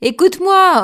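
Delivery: sudden loud high cry; exclaiming. A narrator's reading. Odin cries out to the bear man, loud and high. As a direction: call out urgently to be heard. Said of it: "Odin s'écria".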